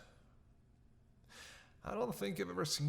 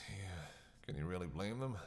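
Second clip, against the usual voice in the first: ordinary talk, nothing marked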